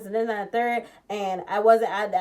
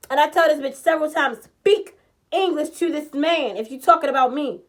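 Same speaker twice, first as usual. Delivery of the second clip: loud, higher, furious storytelling; heated rant; 6 semitones higher, 10 dB louder than usual. Real, not acted. Telling viewers how the host spoke Spanish behind her back, loud angry rant. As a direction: vent it loud and fast.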